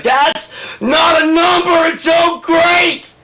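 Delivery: high, loud shrieking shout; astonished astrologers. Acted. Astrologers looking at Trump's grades, high shrieking shout. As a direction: scream it in disbelief.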